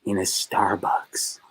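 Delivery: slow unvoiced whisper. Real, not acted. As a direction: breathe it out, barely audible. Ashamed confession ends in whisper.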